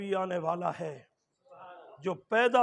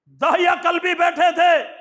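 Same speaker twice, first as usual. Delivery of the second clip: higher, louder sudden outburst; preaching shout; 10 semitones higher, 13 dB louder than usual. Acted. Preacher to congregation, sudden shouted line. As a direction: burst out with it to the crowd.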